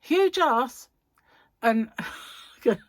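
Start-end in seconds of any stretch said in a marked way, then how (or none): 0.0-0.9 imitating Dave. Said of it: "and he'd go".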